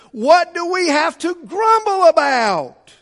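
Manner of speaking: rising shouted preacher's question; preaching shout. Real, not acted. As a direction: cry it out to the congregation. Preacher's climactic rhetorical question.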